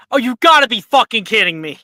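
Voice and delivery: loud frustrated yell. Real, not acted. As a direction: shout it in disbelief. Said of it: "[name]'s yelling".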